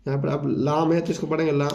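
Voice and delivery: ordinary delivery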